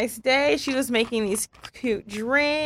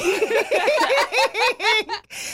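high laugh; 12 semitones higher than usual. Real, not acted. Laughs at friend's diet.

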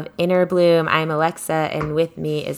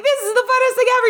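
much higher, very fast delight; gushing thrill; much faster than usual. Retold or imitated. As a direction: her thrilled on the ride. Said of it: "I'd be like".

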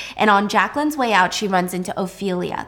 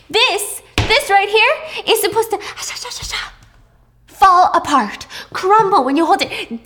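much higher and louder tirade; furious rant; 9 semitones higher, 4 dB louder than usual. Retold or imitated. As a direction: scream at the staff over each cream puff. Heiress rants at bakery staff.

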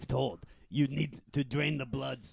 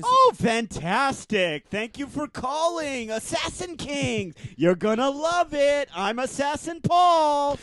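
high, slow, peppy phone greeting; bright call-centre voice, much higher; high chipper salesman; 10 semitones higher, 5 dB louder, slower than usual. Acted. Assassin answers phone, put-on voice.

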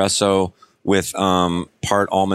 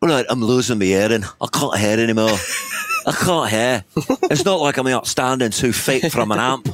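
wobbly, mangled Irish rocker voice; Irish-ish accent weaving in and out; bad Irish accent; 3 semitones higher than usual. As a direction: play a deaf Irish rock singer. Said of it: "You're going Irish?".

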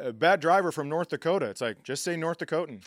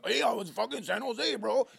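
a hint of the San Jose bro; 8 semitones higher, 3 dB quieter than usual.

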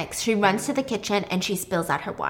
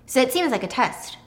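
usual speaking voice

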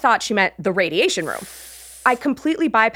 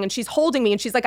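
ordinary delivery

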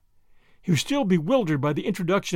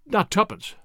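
higher, hesitant dread; faltering; 4 semitones higher than usual. A narrator's reading. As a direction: falter on the name in mild shock. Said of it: "faltered Tommy".

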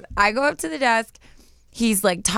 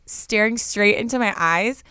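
normal talking voice

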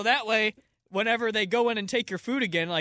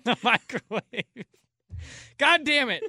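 laughing; 6 semitones higher, 6 dB louder, slower than usual. Real, not acted. Laughing while talking.

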